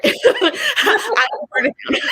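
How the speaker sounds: high laughing declaration, slowed. Real, not acted. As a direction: laugh through a slow, defiant vow. Laughing defiant vow about sister.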